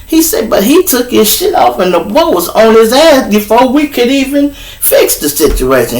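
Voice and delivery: high, exasperated whine; a cop griping that he's in trouble; whiny cop. Retold or imitated. Officer griping to his partner, high and whiny. Cop explaining fight to Sarge.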